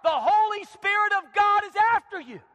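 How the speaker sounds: high, loud preaching climax; shouted warning. Acted. Climax of rebuke to husband.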